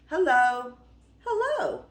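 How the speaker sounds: high, loud, sing-out hello; calling out. A narrator's reading. Beauty arrives at her new home, calling out.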